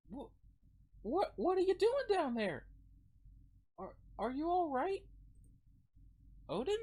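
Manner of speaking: put-on high girl voice, calling out then concerned; worried girl character. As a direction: call out high, then soften with worry.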